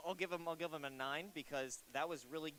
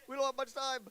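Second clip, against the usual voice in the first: urgent hurry; 8 semitones higher, 7 dB louder, faster than usual. Real, not acted. Rushing next player on stage.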